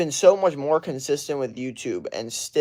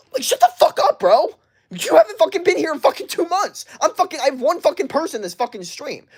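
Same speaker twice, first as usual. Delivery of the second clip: furious rant; 9 semitones higher than usual. Acted. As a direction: tear into them at the top of the voice. Snapping back at absent viewers.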